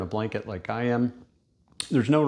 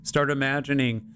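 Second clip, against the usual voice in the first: guided meditation. Real, not acted. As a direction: lead them gently through the body, phrase by phrase, unhurried.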